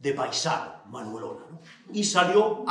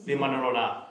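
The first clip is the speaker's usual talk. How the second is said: ordinary delivery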